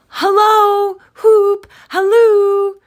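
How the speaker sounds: very high, very loud whooping calls; hallooing. A narrator's reading. Scrooge halloos after Friday running away, whooping. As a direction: whoop it out at full voice.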